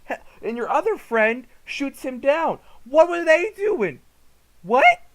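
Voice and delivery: high, loud, incredulous outburst; exasperated disbelief. Real, not acted. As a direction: climb to a baffled yell. Outraged at soldiers' senseless behaviour.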